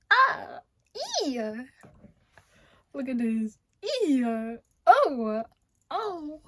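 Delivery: high squealing yelps of delight; excited squeals. Real, not acted. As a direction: squeal at your own arms. Showing off, squealing.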